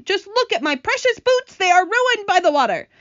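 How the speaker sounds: high, loud indignant wail; dismayed cry. Acted. Centipede mourns his precious boots, dismayed wail. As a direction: lament loudly, pitched far up. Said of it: "cried the centipede".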